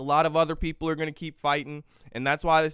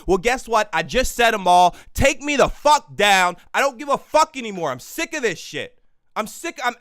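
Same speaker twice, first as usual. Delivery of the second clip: loud, higher, furious shout into the mic; real fed-up rant at full volume; angry yelling; 6 semitones higher, 6 dB louder than usual. Real, not acted. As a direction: yell it, daring them to ban you. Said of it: "Maybe yelling at the microphone".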